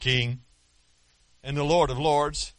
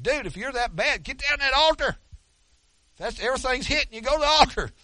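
exasperated onlooker; 9 semitones higher than usual. Retold or imitated. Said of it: "It's like".

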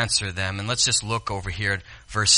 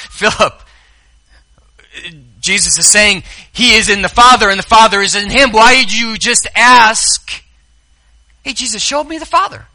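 high, louder, bemused scolding; preacher playfully chiding Philip; mock exasperation; 9 semitones higher, 10 dB louder than usual. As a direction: tease Philip for missing it.

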